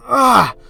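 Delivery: loud, high cry of pain; pained yell. Acted. Ida as his shoulder is crushed, pained yell. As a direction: yelp as if your shoulder is crushed.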